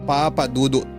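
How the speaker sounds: normal talking voice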